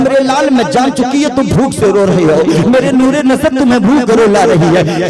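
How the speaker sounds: high, loud tender mother's plea, preached; loving mother. Acted. Mother comforting her crying infant, hammed-up tender pleading. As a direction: speak as a mother soothing her hungry baby. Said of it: "अपने सीने से लगाती है और कहती है".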